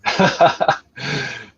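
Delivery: amused chuckle at the banter; laugh. Real, not acted. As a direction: chuckle at your mate's remark.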